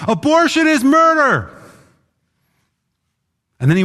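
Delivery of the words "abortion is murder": high, loud yelled proclamation; retold yell. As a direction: yell it out like a protester across a big hall. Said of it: "yells at the top of his lungs".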